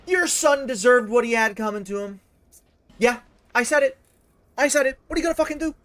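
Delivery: rising to a taunting yell, daring him to react; defiant shouted taunt. Acted.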